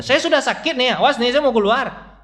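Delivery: high, loud mock baby threat; a grumpy newborn warning it's coming out; angry baby threat.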